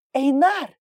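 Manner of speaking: high, loud cry of a name; urgent call. A narrator's reading. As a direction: call out to him in alarm. Sister bursts in, calling him. (громкий высокий окрик)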